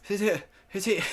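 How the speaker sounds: slurred counting through a held smile; real mumbled count, mouth held stiff; garbled, effortful counting. Real, not acted. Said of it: "Those aren't even words".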